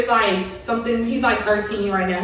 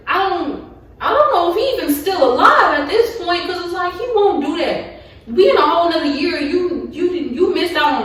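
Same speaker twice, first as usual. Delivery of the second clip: upset outburst; 7 semitones higher, 4 dB louder than usual. Real, not acted. Venting hurt at absent dad.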